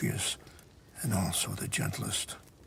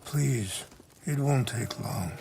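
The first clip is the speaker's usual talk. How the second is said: normal talking voice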